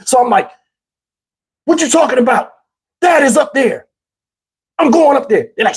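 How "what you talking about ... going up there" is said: put-on higher, insistent child's protest; mock yell at an uncle; retold yelling. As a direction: argue back as a defiant kid, pitch raised. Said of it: "I'm yelling at him".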